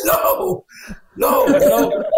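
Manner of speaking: high, half-laughing mock plea; panicked begging. Refusing the wise guy's offer to beat the actor, high panicked plea. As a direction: beg him to stop, voice cracking up high. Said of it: "And I begged him".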